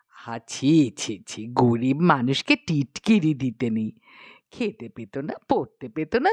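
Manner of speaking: low, tut-tutting queen mother; low chiding queen; queen's voice. A narrator's reading. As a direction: scold gently from low in the voice. Queen voiced in read story.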